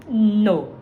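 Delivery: ordinary talk, nothing marked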